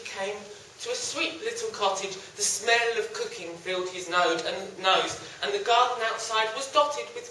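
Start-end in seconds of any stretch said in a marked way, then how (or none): none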